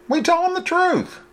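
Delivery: high, light woman's voice; female character voice. A narrator's reading. Woman telling driver they'll confess, lightly voiced. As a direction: voice her as a young woman, matter-of-fact.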